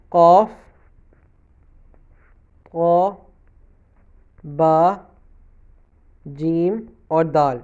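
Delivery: قاف قا با جیم دال each said on its own with pauses; name each qalqala letter clearly, one by one; qalqala letters recited. Shown as a demonstration. Teacher lists the five qalqala letters for students, one by one. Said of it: "یہ وہ والے پانچ حروف ہیں جن کو آپ نے ہلانا".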